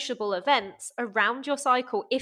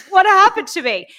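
high, loud hammed-up bewilderment; over-the-top baffled outburst; mock despair; 9 semitones higher, 12 dB louder than usual. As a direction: cry it out in mock horror. Acted despair over lost strength.

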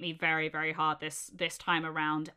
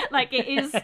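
laughing; 8 semitones higher, 10 dB louder than usual. Real, not acted. Laughing after mock cry.

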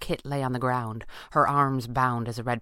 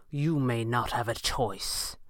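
slow, deadly serious villain; measured, serious warning; perfect seriousness; 2 semitones lower, 4 dB quieter, slower than usual. A narrator's reading. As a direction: state it slowly and in full earnest. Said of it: "said in perfect seriousness".

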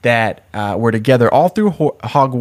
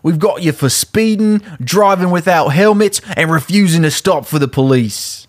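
raised-pitch British policeman reading charges; British accent; 6 semitones higher than usual. Acted. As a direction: list offences in a broad British accent. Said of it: "stop doing the British accent".

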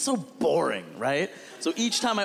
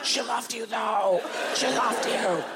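higher, strained acted line; winded; 6 semitones higher, slower than usual. Punched actor on the ground finishes the scene, winded. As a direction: squeeze the line out after a blow.

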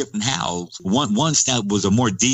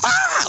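sudden high shriek like a terrified woman on TV; imitated woman's scream. Mimicking a scared woman screaming on TV, a sudden high shriek. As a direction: let out a short horror-movie scream. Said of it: "you see on T V where she goes".

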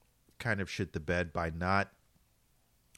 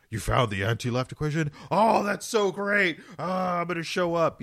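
mock gushing fan; 10 semitones higher, 6 dB louder than usual. Mocking movie characters in a high gushing voice. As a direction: gush over it like a giddy fool.

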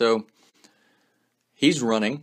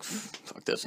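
whispered aside; 10 semitones higher, 12 dB quieter than usual. Retold or imitated. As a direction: whisper it to yourself. Deciding to leave quietly.

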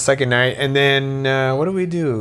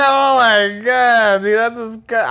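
laughing; 8 semitones higher, 4 dB louder, slower than usual. Real, not acted. Shocked laughter at joke.

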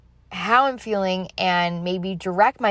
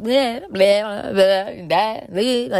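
jumbled babble; much slower than usual. Retold or imitated. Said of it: "I'm like a big bottle of just, like, words".

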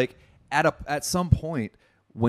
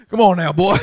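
mock bro; 5 semitones higher, 7 dB louder, faster than usual.

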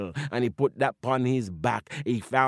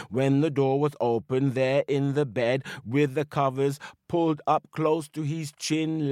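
plain reading voice

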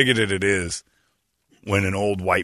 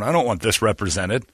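normal talking voice

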